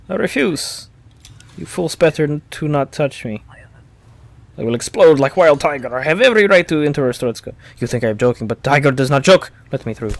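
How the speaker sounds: put-on loud Eastern European accent, defiant; angry accented entrant. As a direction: threaten like a wild tiger.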